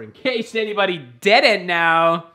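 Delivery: loud, slow declaration; proud announcement. Real, not acted. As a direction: proclaim it to everyone.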